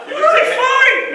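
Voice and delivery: high, slow cartoon bellow; dopey cartoon bellow. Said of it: "this voice is terrible".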